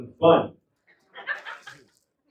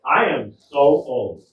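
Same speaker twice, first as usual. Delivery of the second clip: slow, loud, emphatic announcement; call-and-response setup; 7 dB louder, much slower than usual. Acted. Comic cues audience to shout back, slow loud declaration. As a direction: declare it slowly to cue the crowd.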